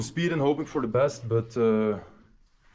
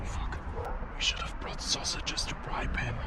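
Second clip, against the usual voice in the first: hushed whisper, sneaking past a dog; 10 dB quieter than usual. Real, not acted. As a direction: whisper so the guards won't hear.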